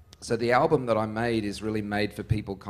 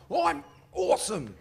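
high put-on bloke voice; pub bloke's reply; 12 semitones higher than usual. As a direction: imitate a bloke at the bar, pitched up. Acts out pub bloke's answer.

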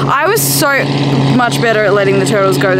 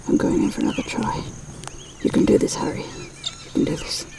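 whispered pep talk; 10 dB quieter than usual. Pretend. Magpie whispering itself courage before grabbing meat. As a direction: whisper courage to yourself as the bird.

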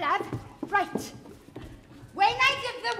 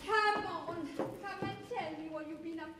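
very soft, lowered voice; soothing; 5 semitones lower, 10 dB quieter than usual. Acted. Mother comforting her pregnant daughter, soft and soothing. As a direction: gently coax her over.

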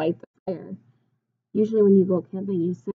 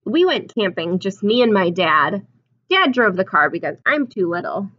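storybook reading; 7 dB louder than usual. A narrator's reading. Reads picture book aloud.

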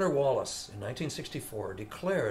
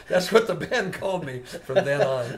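loud laughing talk, higher; delighted laughter, higher and louder; 6 semitones higher, 8 dB louder than usual. Real, not acted. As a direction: laugh through the punchline. Laughs at his nickname.

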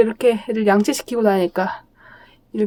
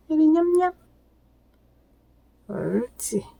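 squeaky praising a dog; 4 semitones higher than usual. Real, not acted. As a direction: coo praise to a small dog. Praising dog during brushing.

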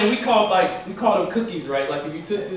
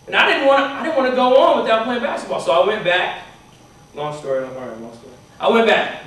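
ordinary talk, nothing marked

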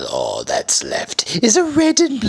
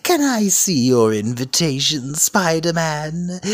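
ordinary talk

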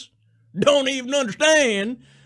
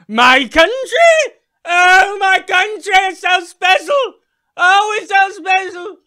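higher, loud, swooning cries; overblown patriotic shout; 7 semitones higher, 4 dB louder than usual. Pretend. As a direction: swoon over your country.

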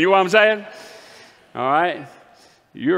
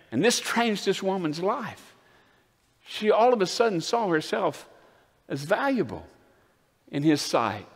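softer, lower, slowed reflection after the climax; hushed preaching; 8 semitones lower, 5 dB quieter than usual. Acted. Pastor quietly telling the church about the changed woman, hushed. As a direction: bring the room down close and quiet.

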